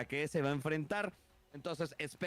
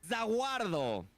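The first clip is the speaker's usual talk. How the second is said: lucha libre announcer; 5 semitones higher, much slower than usual. Acted. Drawn-out ring introduction name.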